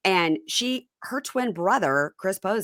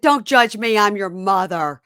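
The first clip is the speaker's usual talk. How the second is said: higher, louder mock-haughty mother line; quoting a catchphrase; 3 semitones higher, 6 dB louder than usual. Mom quoting the show's line to her kids, louder. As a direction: fling it out like a grand mother. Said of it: "It's like".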